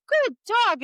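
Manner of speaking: high, excited cheer for the dog; excited praise. Retold or imitated. Judge cheering someone else's dog, excited high praise. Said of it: "I'd go".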